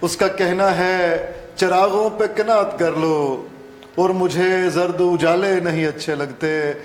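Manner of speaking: projected, measured Urdu verse; ghazal recitation. Acted. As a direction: declaim the couplet phrase by phrase. Guest recites his ghazal.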